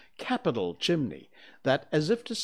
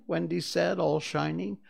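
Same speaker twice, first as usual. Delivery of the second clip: ordinary delivery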